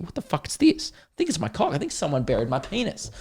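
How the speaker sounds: mock bewilderment. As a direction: act baffled by a startling find, voice shooting up. Acted shock at a buried find.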